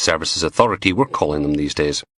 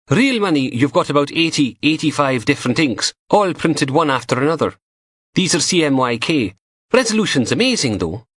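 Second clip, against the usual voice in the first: higher teasing female voice; young woman voice; 8 semitones higher than usual. A narrator's reading. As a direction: play the cheeky lab tech, higher. Narrator voices Samantha.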